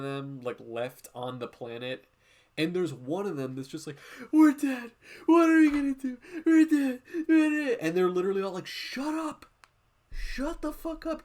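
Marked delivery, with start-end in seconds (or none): 4.3-7.8 loud, high hammed-up panic; panicked shrieking imitation. Streamer mocking a doomed soldier in Aliens, shrieking in panic. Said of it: "shitting his pants and shrieking about it". 8.7-11.2 high, strained mock outburst; mock exasperated outcry. Streamer voicing squad fed up with panicker, mock exasperation.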